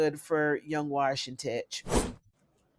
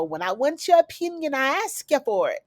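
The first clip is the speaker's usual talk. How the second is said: high, sing-out Jamaican telling-off; bad Jamaican accent; 8 semitones higher than usual. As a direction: put him in his place in a hammed-up Jamaican accent.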